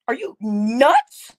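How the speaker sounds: drawn-out disbelief; slow incredulous. Real, not acted. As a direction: let the outrage stretch each word. Disbelief at hateful comments.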